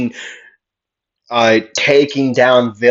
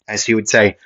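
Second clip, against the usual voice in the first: usual speaking voice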